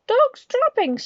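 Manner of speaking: high, loud yell of disgust. Acted. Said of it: "she yelled".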